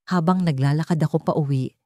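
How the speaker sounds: ordinary delivery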